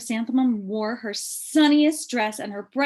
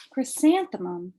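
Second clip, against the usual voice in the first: plain reading voice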